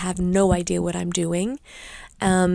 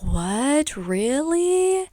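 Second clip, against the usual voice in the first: shocked disbelief; 7 semitones higher, much slower than usual. Retold or imitated. Shocked by strep result.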